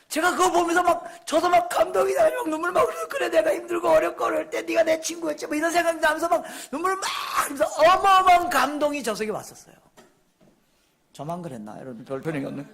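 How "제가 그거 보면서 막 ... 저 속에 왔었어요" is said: high, loud, swept-up testimony; impassioned preaching. Retold or imitated. Preacher retells being moved to tears by a song, impassioned. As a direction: pour out the emotion at raised pitch.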